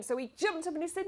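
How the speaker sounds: plain reading voice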